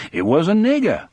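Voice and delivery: lightly mock the sheriff's smug reasoning; a hint of a self-satisfied lawman; sheriff's imagined thoughts. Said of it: "thinks he".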